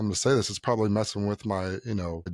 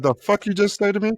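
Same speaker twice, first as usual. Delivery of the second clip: mock angry comeback; 10 semitones higher, 6 dB louder than usual. Retold confronting the shop owner.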